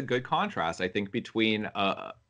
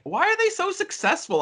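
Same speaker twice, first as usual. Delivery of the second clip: high-pitched incredulous question; baffled protest. Retold or imitated. Film fan baffled by condescending blockbusters, incredulous. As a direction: ask it high, in mock bewilderment. Voices own bafflement at bad films.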